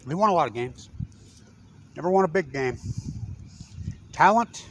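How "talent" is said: sarcastic. Real, not acted. Sarcastic rhetorical question on coach.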